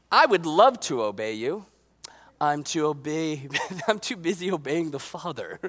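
low, soft excuse, lightly put on; mock-pious child. Kid excusing himself to parents, mock-pious. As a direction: play a kid hiding behind obedience. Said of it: "go home to your parents and go".

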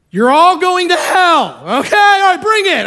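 defiant preacher taunting the mob; bold shout. Retold or imitated. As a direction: yell it at an angry crowd. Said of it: "what are you gonna say".

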